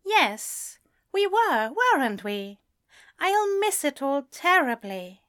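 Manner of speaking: high, wistful character voice; sad smile. A narrator's reading. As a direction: agree gently with a sad smile. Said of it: "Ezra smiled sadly".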